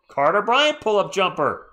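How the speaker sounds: high, loud play-by-play burst; excited shot call. Real, not acted. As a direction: punch the player's name, then come down slightly.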